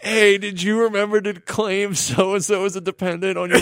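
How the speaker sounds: high nagging Brooklyn wife; Brooklyn accent. Retold or imitated. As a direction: nag like a Long Island housewife. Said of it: "the Long Island or Brooklyn accent".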